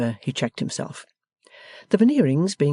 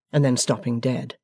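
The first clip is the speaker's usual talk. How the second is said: plain reading voice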